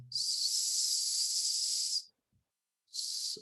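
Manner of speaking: long unvoiced hissing "S-"; whistling S. Shown as a demonstration. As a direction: hold "S-" as a thin whistle. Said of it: "hearing the whistling sound".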